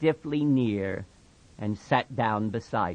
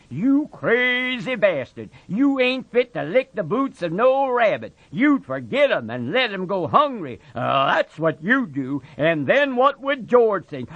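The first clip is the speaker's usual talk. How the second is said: higher, louder sneering voice; scornful rabbit; 9 semitones higher, 5 dB louder than usual. Acted. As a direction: belittle him. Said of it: "it said scornfully".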